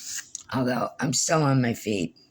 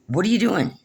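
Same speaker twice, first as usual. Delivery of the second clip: higher, pressing question; mock questioning; 8 semitones higher than usual. Cousin presses what she is doing, high and insistent. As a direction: push the question like a nosy cousin.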